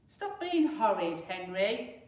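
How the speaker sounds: mother ordering Henry to write letters; scolding. Acted. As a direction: lay down the law.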